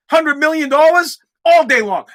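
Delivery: ordinary delivery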